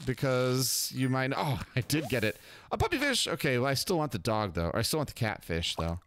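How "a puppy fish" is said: high delighted squeak on the catch; a sudden high yelp of surprise; surprised squeal. Real, not acted. Gamer spotting a puppy fish, squeaks in surprise.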